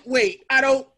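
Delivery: normal talking voice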